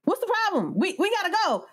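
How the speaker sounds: high-pitched impatient demand; frustrated tourist. Retold or imitated. Tourist to island lady after waiting for the bus, frustrated. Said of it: "we were so frustrated".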